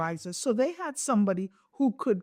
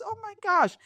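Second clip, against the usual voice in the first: delighted surprise; 13 semitones higher than usual. Real, not acted. Excited reaction to guest story.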